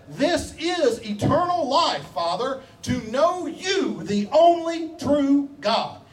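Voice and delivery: high, slow reverent prayer voice; prayerful preaching. Acted. Preacher quoting Jesus' prayer to the Father, slow reverent. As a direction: lift it up slowly like a prayer.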